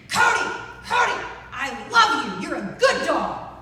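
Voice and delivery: harsh loud high shout at a dog; scolding praise. Shown as a demonstration. Owner to basset hound at the basement door, scolding shout. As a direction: yell loving words as if telling the dog off. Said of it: "what if I were to say".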